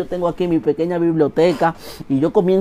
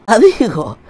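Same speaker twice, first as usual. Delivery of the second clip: eager; 7 semitones higher, 5 dB louder, slower than usual. Real, not acted. Bursting to say it.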